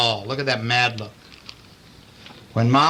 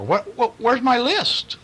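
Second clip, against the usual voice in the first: confused pig; 9 semitones higher, slower than usual. Acted. Pig forgot his list.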